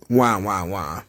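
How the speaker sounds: a sagging, sad trombone wah; mock crying wah.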